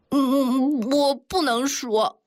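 put-on crying little girl, high and slow; tearful child. A narrator's reading. Ying'er refusing to tell Jiang Daxiao, tearful. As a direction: sob it out slowly in a high child's voice. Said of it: "哭泣地对他说".

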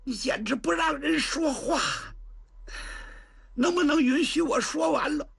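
a younger sister complaining through tears; tearful sister. Acted. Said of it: "把窦仙童急得哭了". (委屈地带着哭腔)

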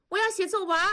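protesting shout from another room; calling from the room. Acted. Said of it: "多多也在屋里喊".

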